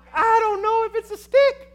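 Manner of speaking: jumps high and loud with nerves; panicking that it might not be a stick; alarmed high voice. Retold or imitated. His inner alarm.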